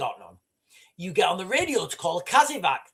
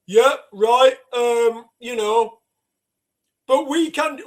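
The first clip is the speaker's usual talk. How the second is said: higher, louder mock-confident voice; mock wishful voice; 4 semitones higher, 6 dB louder, slower than usual. Mocking people who think Britain can cope, higher and louder. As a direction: play the deluded optimist, lightly. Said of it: "people want to think".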